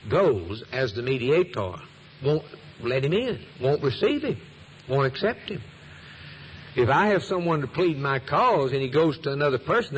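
ordinary delivery

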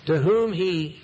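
ordinary talk, nothing marked

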